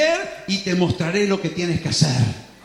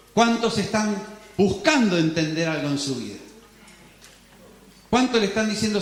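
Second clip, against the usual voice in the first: normal talking voice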